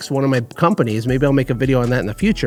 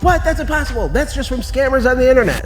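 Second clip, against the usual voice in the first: much higher, incredulous squeal, imitating the listener; mock disbelief; 10 semitones higher than usual. Imitating a viewer who doubts big income, squealing in mock disbelief. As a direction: scoff like it's a scam you'd never fall for. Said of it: "And you're like".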